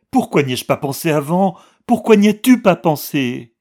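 building to a high, very loud hysterical cry; rising frenzy of reproach. A narrator's reading. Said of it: "dit-elle hystérique". (de plus en plus hystérique, aiguë et très forte)

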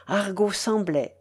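usual speaking voice

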